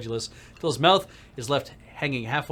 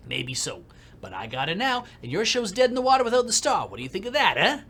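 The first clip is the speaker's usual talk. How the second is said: put-on higher, taunting New York accent; cocky New York producer; taunting New Yorker; 6 semitones higher, 4 dB louder than usual. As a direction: needle him back. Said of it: "Shen Yang".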